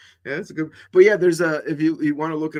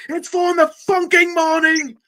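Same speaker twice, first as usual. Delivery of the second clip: high loud mock-angry yelling of the censored version; TV edit yell; 12 semitones higher, 7 dB louder than usual. Quoting the funny TV dub, laughing mock shout. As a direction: yell the bleeped line, breaking into a laugh. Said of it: "he goes".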